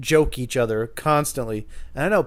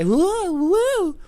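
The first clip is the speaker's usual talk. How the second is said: very high wavering woo; mock drunk whooping; 4 dB louder than usual. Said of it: "He's just a drunk guy".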